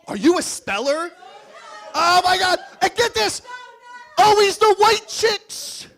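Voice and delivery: high, loud, hammed-up disbelief; mock outrage. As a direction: shriek it like a shocked friend.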